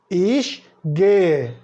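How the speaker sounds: ordinary delivery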